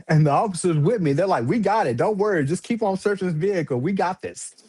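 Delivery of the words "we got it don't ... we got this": higher, lightly voiced cop; calm the others down, in charge; reassuring cop. Officer telling partners to keep searching, lightly voiced reassurance.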